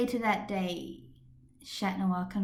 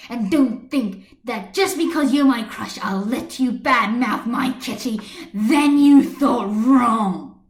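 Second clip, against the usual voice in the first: 1 furious parting shot; 2 semitones higher, 9 dB louder than usual. A narrator's reading. Glaring at Adrian, breathing hard.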